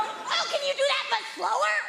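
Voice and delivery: high-pitched mock bedroom request; mock sex voice. Comedian acting out a request during sex, mock sex voice. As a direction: ask it way up high, as comic sex talk. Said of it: "I say that during sex".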